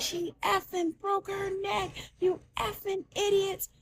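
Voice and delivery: high, tense scolding held back in volume; retold cussing out. Doctor cussing out the floor staff, high mock-angry rebuke. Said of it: "cussed the whole floor out".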